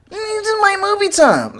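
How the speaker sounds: put-on high, slow, whiny kid voice; whiny son imitation. Mocking his son who wants movie time, high and whiny. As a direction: whine it like a sulking child. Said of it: "I have to tell my son".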